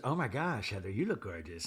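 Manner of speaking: soft, low imitated compliment; Meredith. Meredith greeting Heather, soft low mock compliment. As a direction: keep it hushed and understated, lightly put on. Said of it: "Meredith goes".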